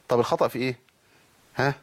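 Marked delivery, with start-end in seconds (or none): none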